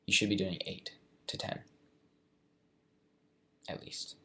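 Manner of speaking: ordinary talk, nothing marked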